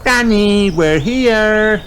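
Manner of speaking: high loud call; Peppa calling Granny. A narrator's reading. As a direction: shout happily through the door.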